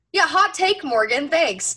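teasing retort to a colleague, sarcastic. Retold or imitated. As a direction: roll your eyes through it. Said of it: "I said".

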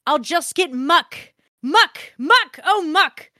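hammed-up ecstatic read of filthy letter; gleeful reading. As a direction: savour each "muck" like a treat.

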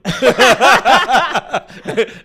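high, loud burst of laughter. Real, not acted. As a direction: crack up at your own joke. Se ríe de su propio chiste.

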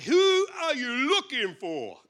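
loud, high, drawn-out demand; bold challenge. Acted. Jesus stepping out to meet the crowd, bold challenge. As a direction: call it out slowly and boldly.

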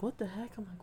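high, hushed bewilderment; groggy confusion. Retold or imitated. Acting out waking up at night, hushed confusion. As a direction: wake up baffled, soft and high.

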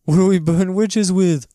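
high put-on comic voice; Monty Python quote. Co-host answers the witch-burning quote, high comic voice. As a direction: pitch it high, playing along with the sketch. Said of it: "That's a reference to, uh, Monty Python".

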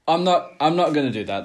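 loud, laughing no; laughing refusal. Real, not acted. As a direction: laugh it off loudly. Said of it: "seems completely disrespectful".

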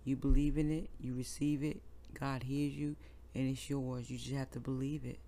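heartfelt prayer. Real, not acted. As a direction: pray aloud gently, like leading a congregation. Leads a prayer on air.

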